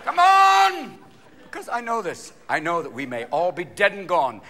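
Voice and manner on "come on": put-on high, loud shrieking old man; hammed-up old man's yell; old Swiss man. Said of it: "Some old Swiss man's going".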